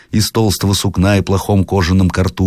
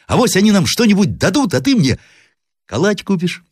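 put-on high, piping child's plea; pleading boy. A narrator's reading. Grandson asks grandmother to admit travellers, pleading. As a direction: beg like an eager little boy.

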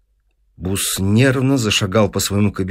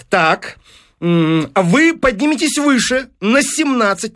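high-pitched, emphatic deal-maker; demanding newspaper businessman; 10 semitones higher than usual. Acted. Businessman setting the professor a record to beat, insistent. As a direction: lay down terms with brisk authority. Voices Fines pressing demands.

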